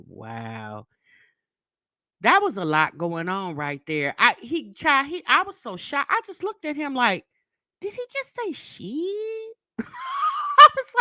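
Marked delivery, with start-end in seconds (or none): 0.1-0.9 low, very soft, underwhelmed aside; stunned deadpan. Retold or imitated. Customer reacting to what was said, low deadpan. 7.8-9.5 high, hushed, slow disbelieving question; stunned disbelief. Retold or imitated. Mother to her son after the cashier cussed, hushed high shock. Shocked look at him retold.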